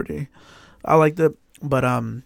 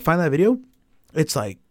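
ordinary talk

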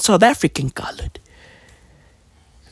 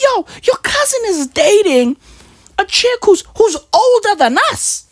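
imitating wife; 11 semitones higher than usual. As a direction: mimic the worried wife, pitched way up. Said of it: "She's like".

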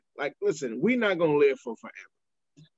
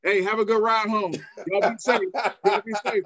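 cheery send-off; 6 semitones higher than usual. Retold or imitated. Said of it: "You kept saying to people".

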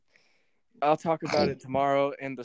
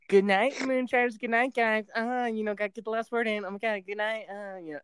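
higher, rambling mock of the friend's goodbye; lightly voiced chatty co-host; higher playful imitation of the co-host getting the last word in; imitating co-host; 8 semitones higher than usual. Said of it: "then she's like".

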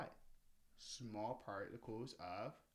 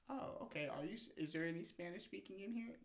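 higher-pitched mock questioner; imitated asker; 7 semitones higher than usual. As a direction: lift the voice as another person asking around. Acts out someone asking in class.

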